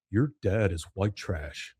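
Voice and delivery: put-on low, slow, nasal-free snide kid; Dudley's voice. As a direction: sneer it slowly and low. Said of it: "Dudley sticks his head in".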